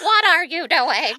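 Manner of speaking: high loud echo of the yell; mock angry shout. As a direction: parrot the outburst, hammed-up. Player echoes the NPC's shout.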